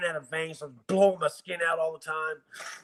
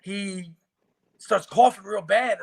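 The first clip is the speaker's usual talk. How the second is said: ordinary talk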